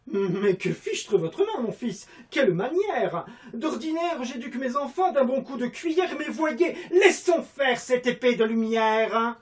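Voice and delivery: loud, high, theatrical classical declamation, laughing; hammed-up classical stage voice; Molière theatre style. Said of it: "à la Molière".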